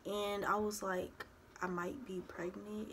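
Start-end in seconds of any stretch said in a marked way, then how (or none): 1.6-2.9 soft, hedging admission; quiet, cautious confession to mom; tentative confession. Retold or imitated. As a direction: retell it timidly, well under normal volume. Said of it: "still beating around the bush".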